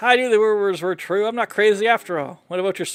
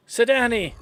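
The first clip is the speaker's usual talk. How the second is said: ordinary talk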